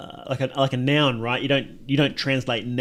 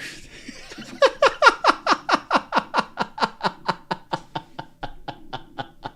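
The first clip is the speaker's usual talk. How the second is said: high laughter. Real, not acted. Cracks up after cowboy voice.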